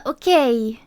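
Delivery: ordinary talk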